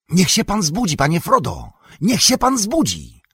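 high, eager call to wake him; Sam calling to wake Frodo. A narrator's reading. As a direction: call him up, bright and urgent. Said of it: "dobiegł do uszu Froda głos Sama".

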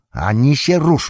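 high, loud soldier's warning; stern shout. A narrator's reading. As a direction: bark the order at the prisoner. Soldier shouts a threat at Gollum.

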